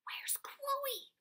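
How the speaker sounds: urgent high whisper yell. A narrator's reading. Dad backstage hunting for his daughter, whisper yell. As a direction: shout it without voice, eyes wide. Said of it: "Dad whisper yelled".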